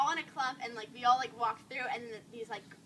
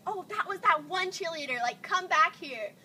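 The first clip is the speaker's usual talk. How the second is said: higher, louder taunt, dragged at the end; slow, drawn-out goading call; mocking heckler; 5 semitones higher, 4 dB louder, slower than usual. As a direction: imitate a girl calling out a challenge. Retells heckler calling her back.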